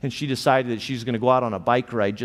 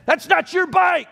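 much higher, louder retold shout; yelling from the porch; 5 dB louder than usual. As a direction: bellow at the bike thief to bring it back. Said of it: "she yelled from the porch".

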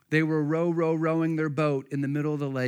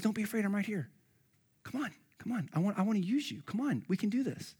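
higher, softer gentle coaxing; reassuring Jesus; 5 semitones higher, 6 dB quieter than usual. Acted. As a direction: softly urge a frightened friend forward.

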